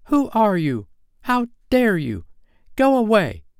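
very high-pitched, offended fairy; high, affronted outburst; indignant cry. A narrator's reading. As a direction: cry out indignantly, drawing out the words. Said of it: "she cried".

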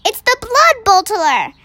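high, frightened warning; fearful giant announcing danger; alarmed. A narrator's reading. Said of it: "cried the B F G".

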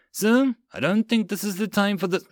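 high, hesitant protest; anxious objection. Acted. Private interrupting officers' quarrel, anxious protest. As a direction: try to reason with a superior.

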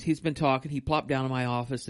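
usual speaking voice

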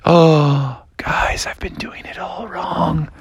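slow, deflated whisper; whispered, drawn-out disappointment; crestfallen realization. Acted. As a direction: let the voice drain away in dismay. Froggy realizes his mistake.

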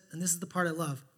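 normal talking voice

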